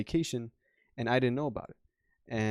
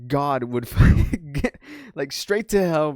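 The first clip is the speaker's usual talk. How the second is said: laughing; 4 semitones higher, 9 dB louder, slower than usual. Real, not acted. Laughs while recounting his cursing.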